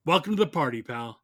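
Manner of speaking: ordinary delivery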